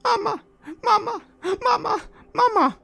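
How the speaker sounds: very high, wailing mama; high, tearful, loud calling for mama; crying child construct. Acted. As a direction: wail like a lost child. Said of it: "The one on the right is saying".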